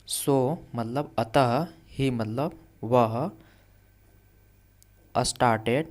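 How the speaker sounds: usual speaking voice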